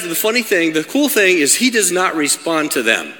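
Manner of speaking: ordinary delivery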